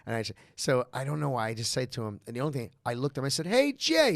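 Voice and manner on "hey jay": high, loud call across the street; excited greeting. Retold or imitated. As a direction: call out brightly to a celebrity. Greeting Jay Leno on street.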